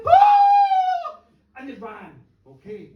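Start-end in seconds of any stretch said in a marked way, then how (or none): 0.1-1.2 a very high, loud drawn-out scream; terrified shriek. Retold or imitated. Three women seeing the mad man leap out, a high shriek. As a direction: scream in pure fright. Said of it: "they went".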